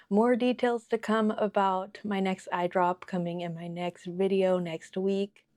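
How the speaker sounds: ordinary talk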